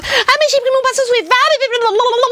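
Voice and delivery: very high, gushing YouTuber imitation; mock squeaky YouTuber. Mimicking YouTubers raving about their Zoeva brushes, squeaky mock voice. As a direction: parody a high-pitched beauty vlogger babbling. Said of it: "parler avec une voix aiguë".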